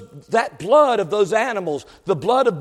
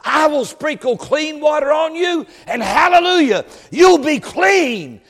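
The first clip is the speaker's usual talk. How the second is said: high, rising preacher's proclamation; full-force preaching; 6 semitones higher than usual. Real, not acted. Preacher declaring God's cleansing promise to the congregation, full-force preaching.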